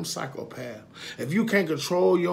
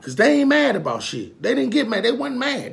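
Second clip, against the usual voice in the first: much higher, louder insistence; emphatic; 9 semitones higher, 7 dB louder than usual. Real, not acted. Man ranting to viewers about others uniting, emphatic and high. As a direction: push it up with conviction.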